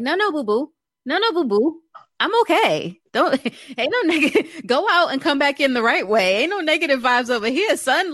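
high-pitched, playful mock scolding; retold sassy telling-off. Acts out scolding the new oncologist.

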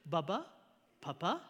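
put-on soft, low toddler question, rising at the end; lightly voiced small child, tentative and quiet; little girl asking. Toddler looks at her big brother, soft hopeful question. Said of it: "she goes".

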